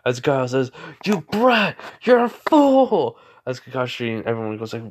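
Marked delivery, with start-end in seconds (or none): 1.0-3.2 high, loud, drawn-out outraged shout; villain screaming insults; angry villain yell. Acted. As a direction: yell it furiously, stretching the last word. Villain yells at hero in story.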